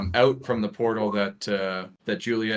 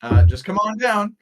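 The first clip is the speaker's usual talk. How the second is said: high, louder welcoming shopkeeper; friendly shopkeeper; 11 semitones higher, 8 dB louder than usual. Acted. Shopkeeper waving to visitors, friendly invitation. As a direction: invite them in, pitched well up. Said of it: "she sort of waves and says".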